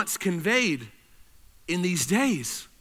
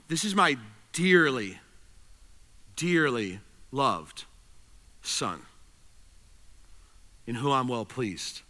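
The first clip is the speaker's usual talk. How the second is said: soft, much lower and slow, lingering on "dearly loved"; tender, dearly loved; 8 semitones lower, 5 dB quieter than usual. Shown as a demonstration. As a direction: hush it down like a father confiding his love. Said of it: "What he's saying is he's saying".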